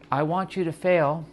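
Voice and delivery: lilting ironic I want you to fail; playful reverse meaning. Shown as a demonstration. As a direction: say fail like you mean win. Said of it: "which basically will then imply that, like, maybe I actually want you to win".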